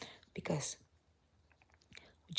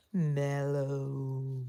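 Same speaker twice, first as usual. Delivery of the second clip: low, drawn-out and dreamy; sleepy mellow drawl; 4 semitones lower than usual. Shown as a demonstration. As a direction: let the word melt lazily. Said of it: "my medications are making me very mellow".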